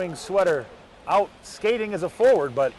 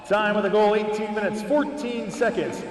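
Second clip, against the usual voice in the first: echoing stadium announcer; slower than usual. Acted. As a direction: stretch the name like a rink announcer. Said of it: "let's hear from the stadium announcer".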